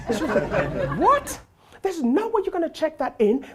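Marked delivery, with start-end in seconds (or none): 1.0-3.5 imitating a high-pitched, loud outraged woman; incredulous counter lady. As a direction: squeal it in mock disbelief, then refuse. Counter lady refuses, acted.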